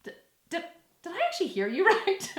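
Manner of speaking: lightly voiced stunned trainer, chuckling; a hint of the disbelieving trainer; incredulous trainer impression. Imitating trainer reacting to her period excuse, lightly disbelieving. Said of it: "Mark would be like this".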